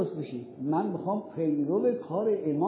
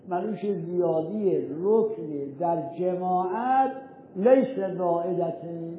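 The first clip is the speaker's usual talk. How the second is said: slow, weighty statement; slower than usual. Shown as a demonstration. Teacher states rule slowly.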